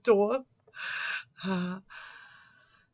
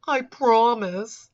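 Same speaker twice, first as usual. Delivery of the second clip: pussified; 5 semitones higher, 6 dB louder, slower than usual. Retold or imitated. Mocking how he types it, a slow whiny promise. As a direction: make it sound weak and whiny. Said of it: "I love the way he says this. It's just so pussified".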